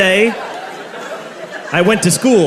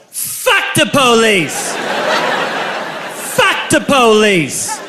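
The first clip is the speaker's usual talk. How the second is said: slow, drawn-out chant, higher; drunk yell; 5 semitones higher, much slower than usual. Retold or imitated. Comic reenacting his drunken yell at the party, slow chant. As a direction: bellow it like a drunk teen. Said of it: "I yelled".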